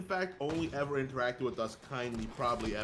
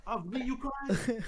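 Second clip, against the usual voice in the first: mock posh echo; 10 semitones higher than usual. Echoing friend's posh voice.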